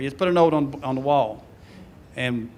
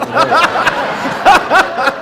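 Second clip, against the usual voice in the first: high loud burst of laughter; real hearty laugh; 11 semitones higher, 7 dB louder than usual. Real, not acted. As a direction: let a big laugh break out. Laughing at the excuse.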